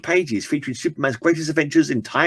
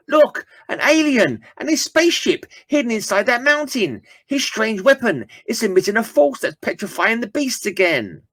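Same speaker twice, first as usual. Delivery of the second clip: high, amazed hero voice, pitched well up; astonished comic hero; 11 semitones higher than usual. Acted. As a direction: gasp it out at the alien ship, high. Comic character exclaims, far voice.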